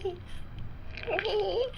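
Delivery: sudden high-pitched repeat; high insistent. Acted. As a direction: pop up high on the word.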